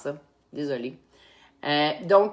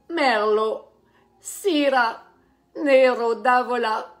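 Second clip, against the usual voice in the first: hammed-up Italian accent, higher, louder and slow; mock Italian accent; 4 semitones higher, 5 dB louder, much slower than usual. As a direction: roll out Merlot, Syrah, Nero d'Avola in a playful fake Italian accent. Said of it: "avec mon accent fantastico".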